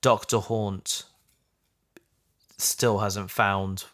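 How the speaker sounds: normal talking voice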